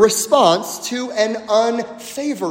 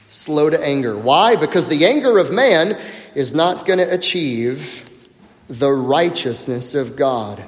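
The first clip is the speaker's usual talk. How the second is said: normal talking voice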